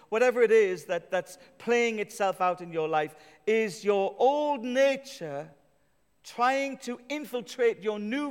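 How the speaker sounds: rousing pulpit declaration; preaching at full force. Acted. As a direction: proclaim it to the whole church. Preaching climax.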